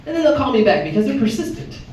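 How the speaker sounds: usual speaking voice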